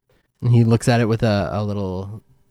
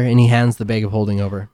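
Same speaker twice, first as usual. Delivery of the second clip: ordinary delivery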